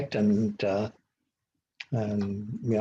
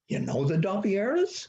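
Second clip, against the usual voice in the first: surprised question; 8 semitones higher than usual. Retold or imitated. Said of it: "And he goes".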